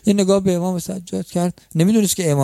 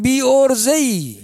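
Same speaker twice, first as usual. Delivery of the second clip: slow, pitched-up scolding of a hopeless man; 9 semitones higher, slower than usual. Acted. Preacher chiding a man who never learned to ask, slow and scolding. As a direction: drag out the reproach.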